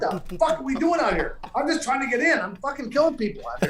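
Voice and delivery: high-pitched, exasperated venting; retold exasperation. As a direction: relive being desperate to get off the course. Retold panic on the course.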